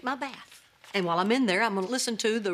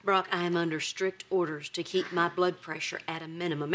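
low, measured, held-back warning; contained voice, pitched low before the blow-up; tightly controlled anger; 5 semitones lower, 3 dB quieter than usual. Acted. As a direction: keep the lid on your temper. Holding temper before blowing up.

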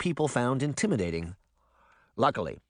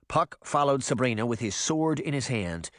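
usual speaking voice

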